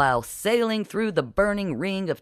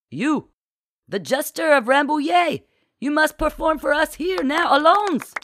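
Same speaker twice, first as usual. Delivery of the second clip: high, louder eager demand; excited demand; 12 semitones higher, 5 dB louder than usual. A narrator's reading. Patron demanding the jester perform, high and loud. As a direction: insist the jester perform now, much higher. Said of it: "Demanding excitedly".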